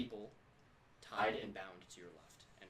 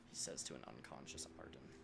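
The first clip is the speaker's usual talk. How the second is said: ordinary talk, nothing marked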